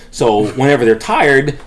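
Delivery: ordinary talk, nothing marked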